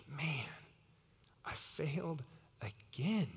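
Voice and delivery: soft, slow, defeated admission; crushed by failure, understated; dejected. Acted. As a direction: let it sink out quietly, broken by pauses. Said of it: "imagine how Peter must have felt".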